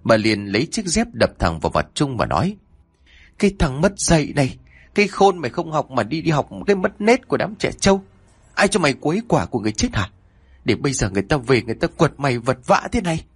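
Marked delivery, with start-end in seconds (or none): 3.4-13.2 giọng cao, nhanh, xối xả; mắng như trút giận lên đứa con hư. A narrator's reading. (bà mẹ giận dữ mắng con, giọng cao và nhanh)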